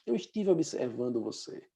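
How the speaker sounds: soft, low and hushed, as if not to startle; gentle approach to child. Acted. Passenger approaching a boy alone on the plane, gently hushed. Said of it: "e ela diz assim".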